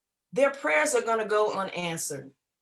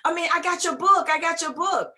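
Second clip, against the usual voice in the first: excited fan; 6 semitones higher, 3 dB louder than usual. Retold or imitated. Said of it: "She said".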